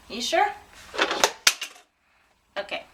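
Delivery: ordinary delivery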